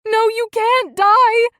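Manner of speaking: high, loud, slow wail; grieving wife screaming at her dying husband; anguished scream. A narrator's reading. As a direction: scream against his death. Husband dies before her.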